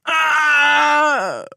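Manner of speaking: high loud howl of pain. Acted. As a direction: howl like the leg is broken. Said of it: "Bob howled".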